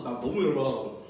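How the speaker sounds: ordinary delivery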